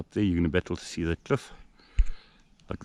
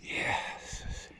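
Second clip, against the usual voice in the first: whispering; 8 dB quieter, much slower than usual. Real, not acted. Hushed in the forest.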